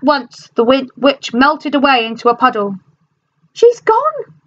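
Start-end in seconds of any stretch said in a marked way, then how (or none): 3.5-4.3 very high joyful cry; cry of relief. A narrator's reading. Dorothy crying out as the witch melts, very high. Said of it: "cried Dorothy".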